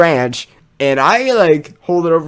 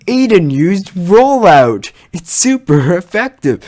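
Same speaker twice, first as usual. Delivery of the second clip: higher, louder, slow game announcer call; mock Pokemon announcer; 6 semitones higher, 7 dB louder than usual. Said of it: "and I'm like".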